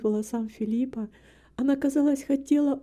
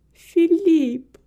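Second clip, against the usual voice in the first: high, loud cry of the name; reproachful exclamation; 5 semitones higher, 7 dB louder than usual. A narrator's reading. Woman protests to her beloved, reproachfully. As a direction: call out his name in reproach. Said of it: "с упрёком воскликнула Эмилия".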